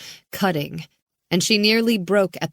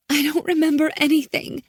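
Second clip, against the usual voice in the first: high, fast frightened answer; panicked girl voice; scared girl; 10 semitones higher, faster than usual. A narrator's reading.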